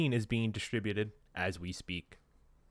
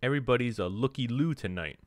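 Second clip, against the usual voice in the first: ordinary delivery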